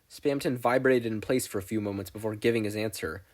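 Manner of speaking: ordinary delivery, nothing marked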